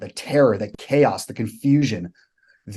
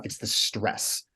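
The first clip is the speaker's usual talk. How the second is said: whisper; 9 dB quieter, much slower than usual. Real, not acted. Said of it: "emphatic aside".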